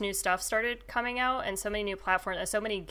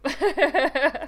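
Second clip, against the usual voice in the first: loud laugh; 8 semitones higher, 8 dB louder than usual. Real, not acted. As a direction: burst of laughter. Laughs at own story.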